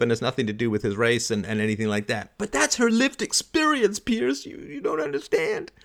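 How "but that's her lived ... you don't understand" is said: high, whining mock indignation; mock upset protest. Debater pleads with his opponent, mock upset protest. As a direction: imitate an exasperated debater, pitched way up. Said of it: "got really annoyed and angry and upset".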